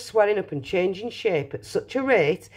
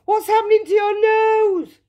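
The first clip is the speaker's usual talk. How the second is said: high, loud shriek of alarm; 6 dB louder than usual. A narrator's reading. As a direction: shriek like a horrified mother. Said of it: "shrieked Miss Beauregard".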